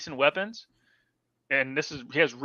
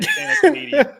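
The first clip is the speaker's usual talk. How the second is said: much higher, laughing burst; 7 dB louder than usual. Real, not acted. Host laughing at himself over a name, high laughing blurt. Laughing at own failed pronunciation.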